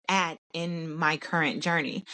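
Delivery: ordinary talk, nothing marked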